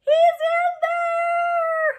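high, loud, excited shout. Acted. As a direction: cry out in delight at finding it. Spotting the bee, excited.

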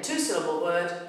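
usual speaking voice

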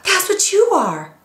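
high, slow, excited blurt; excited husband. Retold or imitated. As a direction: announce it like a discovery. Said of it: "with this excitement, he goes".

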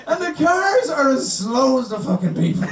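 usual speaking voice

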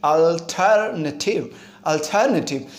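अल्टरनेटिव said slowly as one unbroken word; अल्टरनेटिव drawn out, joined as one word; one-word pronunciation. Shown as a demonstration. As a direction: model अल्टरनेटिव carefully without splitting it. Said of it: "word को एक साथ बोलना है".

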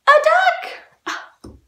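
high, loud exclaimed reveal; pretend surprise. Teacher revealing who's at the door, high mock surprise. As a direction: announce it high, as if amazed.

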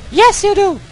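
put-on the girl persona overruling him; squeaky girl voice answering back; English girl voice. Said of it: "girl persona answers back".

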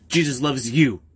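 strange processed voice; effect voice. Acted. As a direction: echo effect voice. Altered voice repeat.

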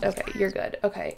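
ordinary talk, nothing marked

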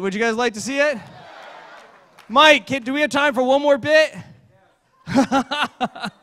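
ordinary talk, nothing marked

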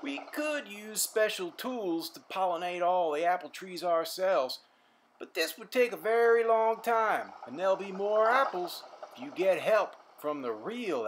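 a jolly farmer giving advice. Acted. The farmer explaining pollination to Simon, hearty. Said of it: "responded the farmer".

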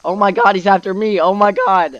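real gaming panic at full volume; freaking out. Real, not acted. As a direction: yell in alarm while being chased. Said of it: "I'm freaking out, dude".

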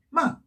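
"마" falling sharply from high; fourth tone. Shown as a demonstration. Teacher reviewing tones, "마" falling fourth tone. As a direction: drop "마" quickly from top to bottom. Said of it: "사 성은".

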